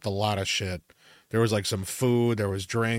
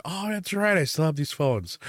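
mock sudden realization; 6 semitones higher than usual. Finding phones years later.

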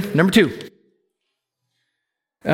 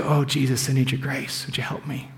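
hushed prayer; 3 semitones lower, 7 dB quieter than usual. Shown as a demonstration. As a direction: drop to a soft, breathy plea, last words nearly whispered. Sample prayer voiced for the congregation.

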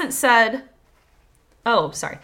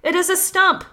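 mild, raised-pitch cry; understated peasant's cry; storybook cry; 6 semitones higher, 3 dB louder than usual. A narrator's reading. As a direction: call it out in a higher voice. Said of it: "cried the peasant".

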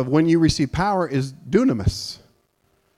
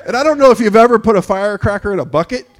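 normal talking voice

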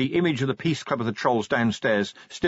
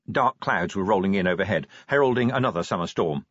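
plain reading voice

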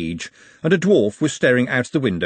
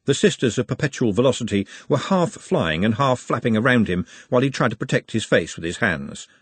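ordinary delivery

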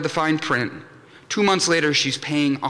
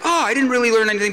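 quick, higher mock audience gripe; mock audience reaction; 7 semitones higher, 3 dB louder, faster than usual. Imagined attendee reviewing the talk, mock-disappointed. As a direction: hurry it out high, imitating a listener.

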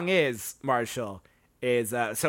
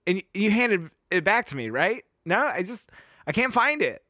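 put-on high, bouncy Valley Girl voice, pitched well up; Valley Girl accent; 6 semitones higher, 4 dB louder than usual. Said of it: "doing this, like, Valley Girl accent".

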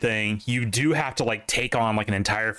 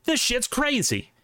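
amazed; 11 semitones higher, slower than usual. Retold or imitated. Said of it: "they're like".

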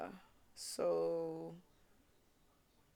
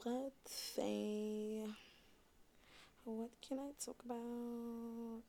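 soft unvoiced whisper; 5 semitones higher, 9 dB quieter, slower than usual. Real, not acted. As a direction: breathe it out under the breath. Thinking aloud, trailing off.

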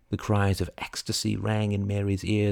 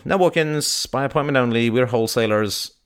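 louder, higher, offhand recital; dismissive shop assistant; 4 semitones higher, 4 dB louder than usual. Acted. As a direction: reel off the brush-off.

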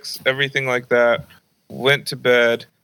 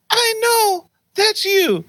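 very high mock-mom voice; accusing mom. Mom telling son she knows it's him, high accusing imitation. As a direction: pile up the proof in an indignant falsetto.

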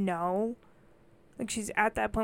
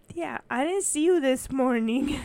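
put-on higher, louder, slow child whine; imitating complaining niece; 4 semitones higher, 6 dB louder, slower than usual. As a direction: draw it out like a complaining little kid. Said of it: "I hear her go".

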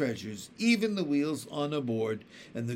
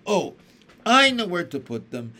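louder, eager burst of an idea; excited cry; 4 semitones higher, 9 dB louder than usual. A narrator's reading. Violet tells her sisters where the dishes go, excited. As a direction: blurt it out, thrilled to share. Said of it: "Violet cried".

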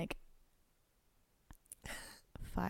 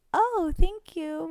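slow, much higher, sweet thank-you; sugary thanks; 10 semitones higher, much slower than usual. Pretend. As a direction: gush it like a polite saint. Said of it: "And be like".

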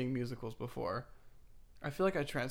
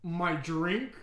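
SpongeBob character; 4 dB louder than usual. Acted. Doing a SpongeBob impression, put-on cartoon voice. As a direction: say it as a startled cartoon customer. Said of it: "impression of that one guy from SpongeBob".

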